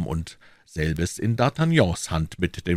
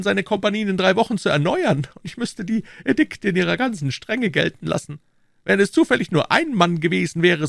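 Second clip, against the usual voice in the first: high, scolding royal voice; 13 semitones higher than usual. Acted. King scolding the musketeers, high indignant voice. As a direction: reprimand them from the throne.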